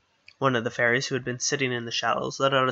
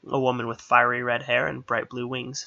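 ordinary delivery, nothing marked

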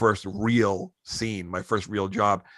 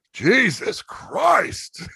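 very slow, high drawn-out groan of a curse; mock-weary sigh of a line; exasperated; 12 semitones higher, 4 dB louder, much slower than usual. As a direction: let it out slowly like a fed-up star. Pacino exasperated at cut.

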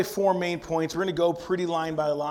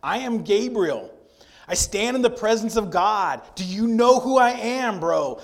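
higher, louder indignant angel; Gabriel, indignant; 6 semitones higher, 6 dB louder than usual. Retold or imitated. Preacher voicing Gabriel rebuking doubting Zechariah, loud and indignant. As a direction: boom it out like an offended archangel. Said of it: "this is my intonation, my inflection".

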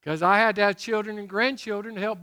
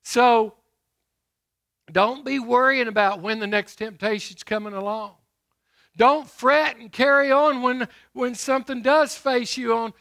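loud, ringing pulpit delivery; booming sermon voice; preaching at full force; 6 semitones higher, 9 dB louder than usual. Acted. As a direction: preach it out to the congregation. Preacher exhorting.

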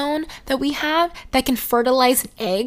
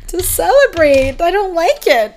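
higher-pitched mortified protest; 7 semitones higher, 5 dB louder than usual. Retold or imitated. Daughter to celebrating parents, protesting. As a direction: plead with them to stop, pitch raised.

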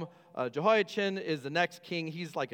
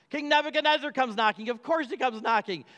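higher, louder reveal of the king; triumphant reveal; 7 semitones higher, 5 dB louder than usual. Acted. Preacher revealing who knocks at the door, higher and louder. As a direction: land the answer big for the church.

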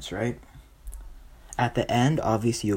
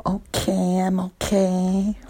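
high, drawn-out creepy reply; odd neighbor; 9 semitones higher, slower than usual. Acted. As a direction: pitch it way up, slow and weird. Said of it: "And she goes".

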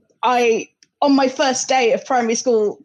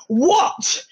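higher, challenging London "what", T dropped; tough South East London accent; 6 semitones higher than usual. Retold or imitated. New kid bluffing to a local, mock-tough London accent. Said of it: "trying to pose as being tough".

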